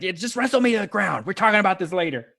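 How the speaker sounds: high, fast, irritated aside; acted urgent growl. Hurt wrestler grappling his opponent, acted rushed irritation. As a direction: rush it out mid-grapple. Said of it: "he just kinda gravels you".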